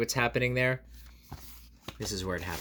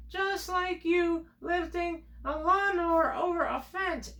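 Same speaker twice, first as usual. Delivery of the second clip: much higher, teasing squeak; mock high voice; slower than usual. Telling kids a silly comparison, high mock voice.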